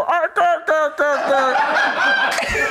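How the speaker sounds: alien growls. Retold or imitated. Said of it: "they go".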